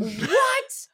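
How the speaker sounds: high, stunned What; shocked. Real, not acted. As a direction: blurt it out in disbelief. Reacts to confession.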